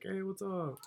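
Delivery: high, cheery retold hello; smiling greeting. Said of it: "I was smiling at first".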